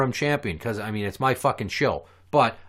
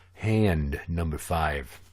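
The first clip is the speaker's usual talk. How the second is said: announcer voice; 7 semitones lower, 4 dB quieter, much slower than usual. Acted. Low slow game announcement.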